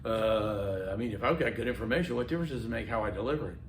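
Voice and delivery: low, quick, quiet objection; hushed, rushed student's question; sceptical student. Retold or imitated. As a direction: imitate a student doubting aloud, soft and rapid. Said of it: "I've had students look at me and say".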